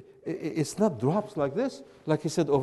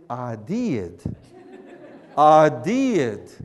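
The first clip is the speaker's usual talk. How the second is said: drawled Southern "I did", lower in pitch; slow Southern drawl on I did; Southern accent; 5 semitones lower, 7 dB louder, much slower than usual. Shown as a demonstration. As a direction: flatten I to "ah" and stretch did into two syllables. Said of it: "you say".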